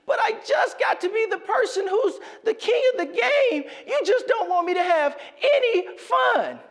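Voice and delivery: put-on high, loud, wailing little boy; crying child's outburst. Son melting down at dad over long johns, high wailing shout. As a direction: wail it like a toddler in a meltdown.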